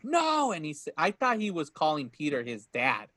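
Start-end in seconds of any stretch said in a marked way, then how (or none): 0.0-0.5 high, drawn-out mock cry of no; retold dramatic no. Friend retelling the stabbing scene to a co-host, high mock cry. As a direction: imitate Peter's anguished high no. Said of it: "And Peter's like".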